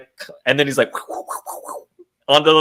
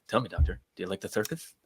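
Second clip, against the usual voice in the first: imitating Val Kilmer; 5 semitones lower, 14 dB quieter than usual. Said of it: "Val Kilmer talking to, like, uh, Nicole Kidman".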